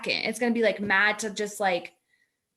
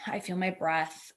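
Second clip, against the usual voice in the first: calm grounding; 5 semitones lower, 8 dB quieter than usual. Shown as a demonstration. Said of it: "wiggle your toe".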